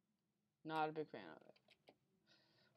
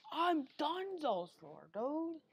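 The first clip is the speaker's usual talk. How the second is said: exasperated; 9 semitones higher, 5 dB louder, much slower than usual. Real, not acted. Frustrated outburst while gaming.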